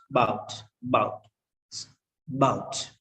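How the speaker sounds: "bout" as one syllable; unstressed A dropped. Shown as a demonstration. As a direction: say "about" as just "bout", repeated. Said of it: "Uh is not pronounced".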